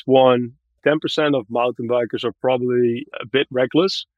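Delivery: normal talking voice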